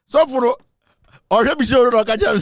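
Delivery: excited. Real, not acted. Excited retelling about the pastor.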